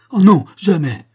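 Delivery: high, loud desperate cry; wife crying out loud and high to stop the duel. A narrator's reading. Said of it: "s'écria-t-elle".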